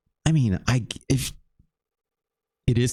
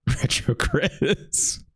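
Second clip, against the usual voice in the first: drawn-out, laughing name, higher pitched; 6 semitones higher, much slower than usual. Real, not acted. Streamer reacting to a game a viewer sent, laughing. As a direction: stretch the name out through a laugh.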